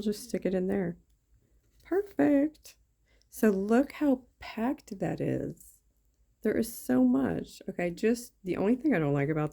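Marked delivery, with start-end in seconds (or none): 1.8-2.7 high, pleased "Perfect"; delighted. Real, not acted. Satisfied with placement. 3.3-7.6 slow, high and marvelling; impressed. Real, not acted. Showing off stuffed kit.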